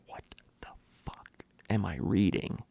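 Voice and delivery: hushed disbelieving whisper under the breath; stunned whisper. Retold or imitated. Reader whispering in shock at the book, hushed disbelief. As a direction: whisper it like you can't believe the page.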